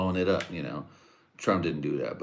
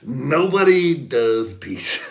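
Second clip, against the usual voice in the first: high, loud, very slow boast; mock Trump; 8 semitones higher, 9 dB louder, much slower than usual. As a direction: draw it out grandly. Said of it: "thought that shit was funny".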